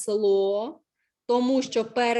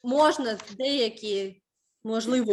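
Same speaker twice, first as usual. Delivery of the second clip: ordinary talk, nothing marked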